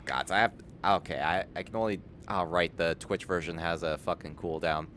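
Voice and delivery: usual speaking voice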